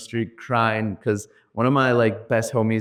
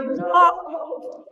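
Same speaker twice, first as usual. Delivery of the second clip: much higher flat-out no; exasperated. Retold or imitated. Speaker to auto driver, exasperated refusal. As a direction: shut him down.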